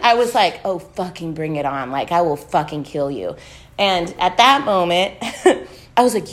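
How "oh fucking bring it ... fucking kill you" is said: low, softened threat; retold quiet menace. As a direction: dare them under your breath, low and cold. Retold inner threat to owner.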